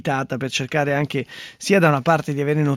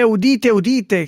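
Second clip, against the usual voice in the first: town crier; 5 semitones higher, 3 dB louder, slower than usual. Pretend. Mock proclamation to listeners.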